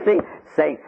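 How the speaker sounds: ordinary talk, nothing marked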